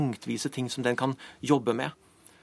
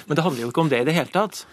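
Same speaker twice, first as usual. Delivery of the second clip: usual speaking voice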